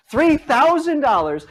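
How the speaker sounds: high, slow prize call; booming prize announcer. Pretend. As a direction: hype the prize like a game show. Host hyping the prize.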